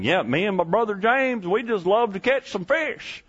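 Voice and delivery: put-on high, eager country-boy fisherman voice; [name] as country boy. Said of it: "I know how they talked".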